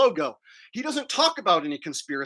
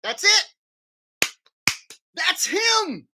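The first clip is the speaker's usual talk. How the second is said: shouting; 10 semitones higher, 3 dB louder, slower than usual. Real, not acted. Shouted comment on impression.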